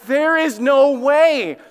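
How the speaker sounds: exasperated there is no way; frustrated disbelief. Retold or imitated. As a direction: refuse to believe it, drawn out. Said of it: "I am so frustrated".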